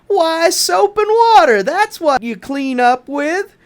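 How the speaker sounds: high bright eureka tone; sudden idea. Acted. George's idea voiced.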